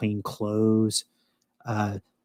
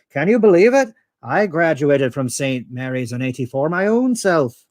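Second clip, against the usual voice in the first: put-on Irish accent joke; 3 semitones higher than usual. Telling an Irish joke to the audience in hammed-up Irish accent. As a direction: tell the joke in a hammy Irish lilt. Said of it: "it's an Irish joke".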